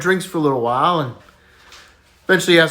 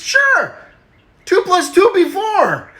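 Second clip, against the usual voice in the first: put-on much higher kid voice, louder; high punchline; 4 dB louder, slower than usual. Johnny answers the teacher, high and cheeky.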